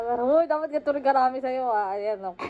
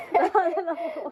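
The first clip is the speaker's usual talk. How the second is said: excited; 6 semitones higher, 4 dB louder than usual. Real, not acted. Repeated, higher and louder.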